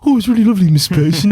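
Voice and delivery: put-on high-pitched, suddenly polite teenage lad; Perry. Boy answering his friend's mum, high polite voice. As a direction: drop the act and be sweetly polite. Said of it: "Perry instantly takes the sunglasses off".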